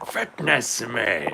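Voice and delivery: slow drawn-out fitness me, hammed-up; mock gym war cry. Streamer acts out the chat pun as a hammed-up slow gym war cry. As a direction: shout it like a gym battle cry.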